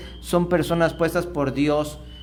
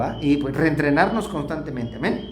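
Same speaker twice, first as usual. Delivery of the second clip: ordinary talk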